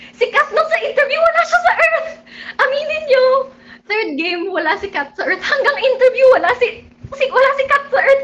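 high, loud teasing through laughter; laughing teasing. Real, not acted. Vlogger teasing spaced-out Kath to fans, laughing high. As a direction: tease her, laughing high.

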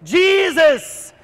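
high, drawn-out pleading cries; acted shouting. Blind man begs Jesus for mercy, acted shouting. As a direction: cry out slowly, climbing in pitch. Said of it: "He began to shout".

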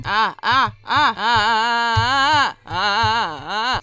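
loud, drawn-out cries of "ah"; loud mock-panicked "ah ah ah"; panicked wailing. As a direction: wail helplessly in repeated bursts. Blown about by game wind.

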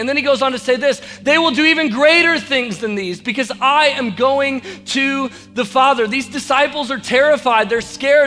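high, loud, driving sermon voice; full-force preaching. Real, not acted.

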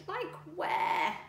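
ordinary delivery

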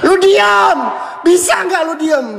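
high, sharp shout at the ghost; angry yell. Real, not acted. Yells at the unseen ghost. (bentakan marah ke hantu)

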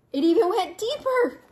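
high, excited storytelling; dramatic alarm. A narrator's reading. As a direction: raise the suspense with a high voice. Dramatic moment in read-aloud story.

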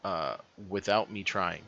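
ordinary talk, nothing marked

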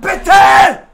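loud, high outburst; desperate shouted plea; frustrated scream. Real, not acted. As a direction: yell it in sheer exasperation. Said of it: "du spuckst und schreist so".

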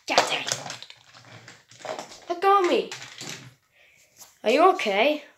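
ordinary delivery

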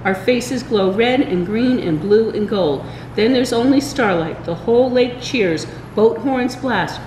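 plain reading voice

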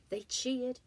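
ordinary delivery, nothing marked

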